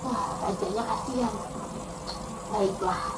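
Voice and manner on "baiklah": soft, low royal assent; relenting king. Acted. King taking pity on the weeping servant, soft and low. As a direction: give in quietly, pitched down. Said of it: "kata raja".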